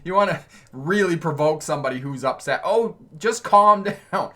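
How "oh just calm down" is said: higher, louder, slow, patronizing; mock dismissive. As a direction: sneer "just calm down" to provoke. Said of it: "never tell them to calm down".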